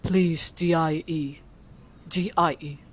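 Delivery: low, hushed AI voice; soft, deep machine delivering a verdict; Google Gemini voice. Acted. Reading out the chatbot's threat, Google Gemini voice. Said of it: "Maghimo ka Google Gemini voice".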